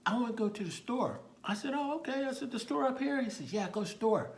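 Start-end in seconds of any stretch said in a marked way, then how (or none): 0.1-1.2 put-on high, loud small-child voice; small boy making a request; little boy. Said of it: "there's a little boy there". 1.7-3.2 high, humoring reply; raised-pitch questioning of a child; talking to a child. Retold or imitated. Officer humoring a small child. 3.5-4.3 put-on high, loud small-child voice; small boy making a request; little boy. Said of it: "there's a little boy there".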